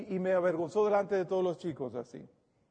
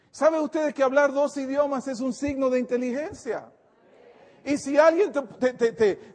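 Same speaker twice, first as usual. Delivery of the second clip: rising, louder sermon push; fervent preaching; 7 semitones higher, 5 dB louder than usual. Acted. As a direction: preach it with conviction to the flock. Pastor exhorting the congregation.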